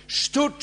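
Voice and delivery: "stood" punched high and loud; one high, forceful "stood"; emphatic STOOD. Shown as a demonstration. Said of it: "The emphasis up, is, is upon standing".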